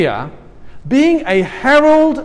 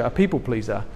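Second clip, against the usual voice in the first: ordinary talk, nothing marked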